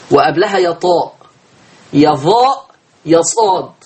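normal talking voice

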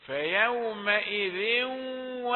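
"فيومئذ" flowing into a hummed w; merged tanween. Shown as a demonstration. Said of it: "تنوين وأدغم في الواو". (إدغام تنوين "فيومئذ" في الواو بغنة)

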